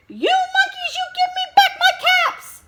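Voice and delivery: a hammed-up angry peddler yelling at the monkeys; really angry peddler. A narrator's reading. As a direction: shake both hands and shout at the monkeys. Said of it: "This made the peddler really angry".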